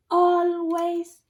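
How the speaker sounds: "Always" drawn out high and loud; emphatic always. Shown as a demonstration. Teacher answering who's the last line, stretched "Always". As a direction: hold "Always" long before the answer.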